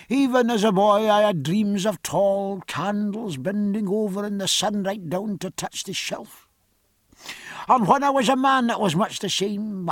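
dreamy Scottish confession; broad Scottish accent. A narrator's reading. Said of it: "going on in a different voice".

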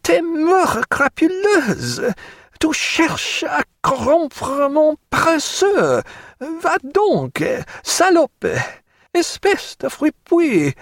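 very high, drawn-out French tirade; furious scolding. A narrator's reading. Stephen berates Jack in French behind bulkheads, furious high scolding. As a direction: hurl insults in a high, outraged voice.

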